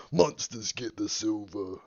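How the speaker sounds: slow, low Aussie growl; deep Australian Witcher voice. Pretend. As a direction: drawl it out like a grim Aussie hunter. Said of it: "deep voice Witcher Australian".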